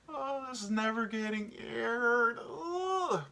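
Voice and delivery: slow, high, laugh-choked groan; cry-laugh it out; laughing at his own slip; laughing despair. Real, not acted. Laughing embarrassed.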